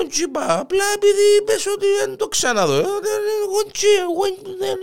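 very high, whimpering excuse; panicked backpedalling. Acted. Hare making excuses to the offended lion, panicked. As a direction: squeal out excuses in mock fright.

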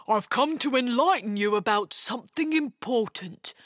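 ponderous creature voice; put-on ogre voice, earnest explanation; solemn ogre. A narrator's reading. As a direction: enlighten a human, pompous and nervous.

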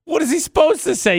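high-pitched, loud protest; high exclaim. Real, not acted. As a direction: squeal it out in protest. Defending the groom.

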